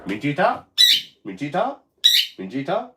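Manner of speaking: high, rising call to the bird; sing-song the name up to get her attention; coaxing call to the parrot, high and rising; calling the pet. Real, not acted.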